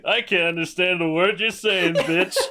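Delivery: high-pitched mock drunk outburst; comically drunk, belligerent. Drunk brother to a lady speaking another language, mock belligerent. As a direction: imitate a belligerent drunk, breaking into laughter at the end. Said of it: "really comically drunk and, like, belligerent".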